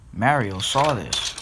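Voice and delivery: usual speaking voice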